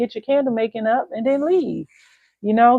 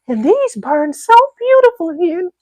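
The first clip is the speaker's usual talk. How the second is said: mock soap lady; 9 semitones higher than usual. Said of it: "that was what she swore by".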